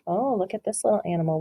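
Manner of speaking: ordinary delivery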